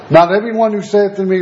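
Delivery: usual speaking voice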